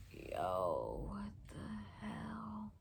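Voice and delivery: slow, faint whisper of disbelief; dazed whisper. Retold or imitated. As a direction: breathe it out, stunned. Waking dazed after crash, retold.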